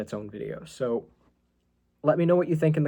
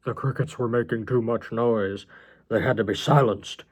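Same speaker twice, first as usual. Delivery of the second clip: sinister John. Acted. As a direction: deliver it as an ominous confession.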